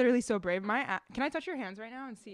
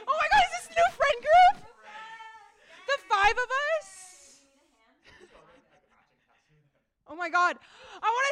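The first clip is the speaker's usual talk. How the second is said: high, loud, gushing over the new friend group; excited squeal; 11 semitones higher, 9 dB louder than usual. Real, not acted. Friend gushes to the group about hanging out, squealing with excitement. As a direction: shriek it with delight.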